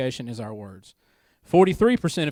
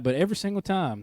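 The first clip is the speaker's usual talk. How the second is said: ordinary talk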